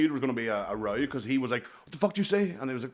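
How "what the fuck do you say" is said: high-pitched imitation of an aggressor; retold challenge. Man confronting him before the punch, high imitated challenge.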